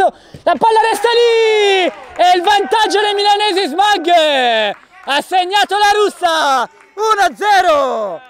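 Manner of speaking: excited goal call, rising high; goal shout. Real, not acted. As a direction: yell out the goal. Goal called in live commentary.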